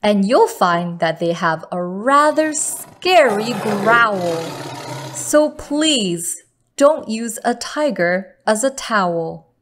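plain reading voice

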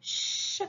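"ch" as a long voiceless shhh; isolated ch sound. Shown as a demonstration. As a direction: hold "ch" softly, with no vowel. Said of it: "On garde". (« ch » soufflé et prolongé, sans voyelle)

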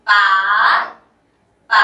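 ปา dipping down first then rising up. Shown as a demonstration. Teacher to learners: ปา in the rising tone. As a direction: take ปา down then sweep it up. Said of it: "rising tone two step down and up".

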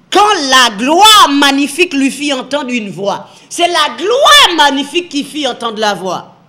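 loud, emphatic reading of the verse; forceful preaching. Acted. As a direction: drive the verse home to the faithful. (lecture prêchée avec force)

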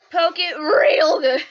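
loud, slow hammed-up yell; mock yell. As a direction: bellow it like a silly punchline. Playful yelled punchline.

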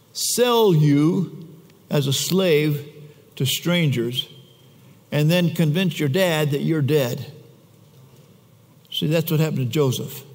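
normal talking voice

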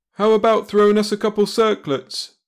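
high, loud call; crying out. Acted. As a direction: shout it up to them. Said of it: "Brad cried".

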